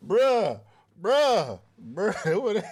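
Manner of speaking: loud high laughing bruh; laughing outburst. Real, not acted. Host cracks up at the co-host's advice. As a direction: crack up and groan in disbelief.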